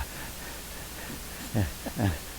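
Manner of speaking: breathy out-of-breath panting, acted lightly; faked panting. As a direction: pant as if winded from the stairs. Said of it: "I would come on stage and go".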